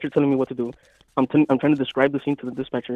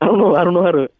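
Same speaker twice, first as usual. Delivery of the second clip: laughing; 5 semitones higher, 7 dB louder than usual. Real, not acted. Laughing while admitting fault.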